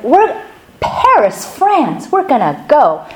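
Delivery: mock-excited family voice. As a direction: relish the place name, acting out their excitement. Retelling his family's excitement.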